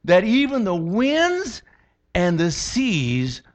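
high, slow amazed question; awed wonder. Retold or imitated. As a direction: sailors stunned by the calm, awed.

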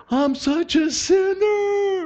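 very high, drawn-out imitated wail; mock weeping wail. Imitating his roommate breaking down at the gospel, mock weeping wail. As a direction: wail it slowly in a high crying voice. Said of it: "he's weeping and wailing".

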